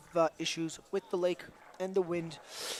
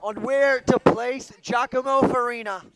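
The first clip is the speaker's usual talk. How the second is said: sports commentator; 5 semitones higher, 9 dB louder than usual. Acted. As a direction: build suspense like a TV announcer.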